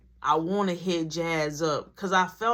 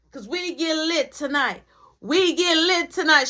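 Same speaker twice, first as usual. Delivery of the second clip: high, hyped-up party chant; tipsy mom; 5 semitones higher, 3 dB louder than usual. Retold or imitated. Mom announces a night of partying, tipsy. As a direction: imitate a lit mother celebrating. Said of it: "My mom lit".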